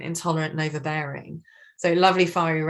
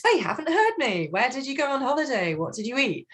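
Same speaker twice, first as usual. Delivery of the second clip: eager questions; 7 semitones higher than usual. Retold or imitated. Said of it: "sunshine yellow thinks".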